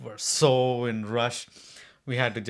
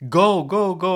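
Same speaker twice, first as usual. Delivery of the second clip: raised, higher-pitched urging; 7 semitones higher, 6 dB louder than usual. Retold or imitated. Retelling pleas to a stalled driver, urgent. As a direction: urge a driver on, louder and higher.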